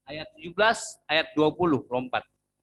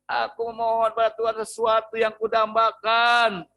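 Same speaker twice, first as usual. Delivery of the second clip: higher, louder pleading woman near tears; tearful plea; 8 semitones higher, 5 dB louder than usual. Retold or imitated. Hana answers the priest, pleading higher and louder. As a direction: beg the priest, voice lifted and hurting. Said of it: "dia menangis".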